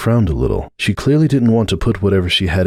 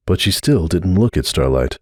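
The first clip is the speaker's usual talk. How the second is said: plain reading voice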